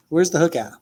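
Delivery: usual speaking voice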